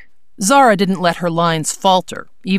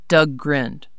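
plain reading voice

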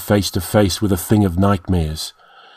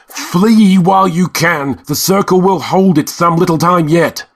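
urgent cry; 8 semitones higher than usual. A narrator's reading. Red Priest urging the men to escape. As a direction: order them out at full voice. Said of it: "cried the Red Priest".

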